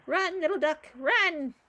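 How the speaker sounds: loud, high cheer-on call; playful urging. Pretend. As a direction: call out to a fleeing duck. Urging on a fleeing game duck.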